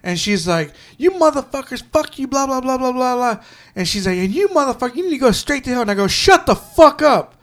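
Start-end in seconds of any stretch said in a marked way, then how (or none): 1.0-3.4 high-pitched mock rant; retold cussing customer. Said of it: "She started cussing at me". 4.2-5.8 high-pitched mock rant; retold cussing customer. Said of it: "She started cussing at me". 6.1-7.3 high, loud, drawn-out outburst; retold angry yell. Retelling his outburst at cursing customer.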